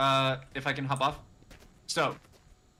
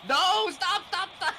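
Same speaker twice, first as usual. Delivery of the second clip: frantic yelling; 13 semitones higher, slower than usual. Real, not acted. Frantic cry to stop.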